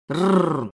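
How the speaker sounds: low rolled growl; wolf cub growl. Acted. Wolf cubs facing the stranger, aggressive growl. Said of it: "Волчата ощерились".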